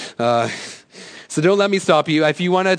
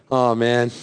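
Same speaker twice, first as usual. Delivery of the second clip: normal talking voice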